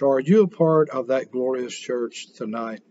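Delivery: ordinary talk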